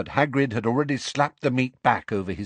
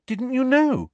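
very high, astonished question; wide-eyed surprise; 4 dB louder than usual. A narrator's reading. Hermione finding Hagrid hadn't heard, astonished. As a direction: squeak it in disbelief. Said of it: "Hermione asked him, wide-eyed".

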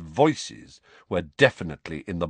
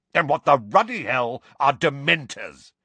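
loud, high, blustering question; exasperated demand; 7 semitones higher, 5 dB louder than usual. A narrator's reading. Uncle demanding explanation from nephew, exasperated. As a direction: demand an answer irritably.

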